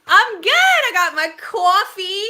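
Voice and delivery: loud, high, hammed-up Bronx accent; playful stab at a Bronx accent; attempted Bronx accent. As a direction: try hard at a Bronx accent, stretching the words. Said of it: "I tried to do a Bronx accent".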